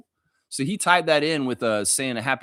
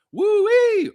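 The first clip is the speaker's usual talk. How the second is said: high shouted whoop; wrestler whoop; 8 dB louder than usual. Retold or imitated. Host doing a wrestling whoop, high and loud. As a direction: let out a Ric Flair style wooo. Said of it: "old school wrestling impersonations".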